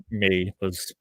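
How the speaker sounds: usual speaking voice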